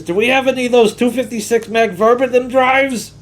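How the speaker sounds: the grovelly coworker asking for drives that are long gone; Delroy impression. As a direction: strain the voice up into a grovelly whine. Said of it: "that hurts my voice to do that".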